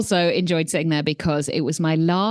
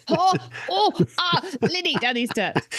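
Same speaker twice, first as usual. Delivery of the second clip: high, hammed-up groans of sore legs; stiff-legged groaning; 9 semitones higher than usual. Runner with stiff legs chasing Lindy down steps, mock groaning. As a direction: act out achy yelps, much higher.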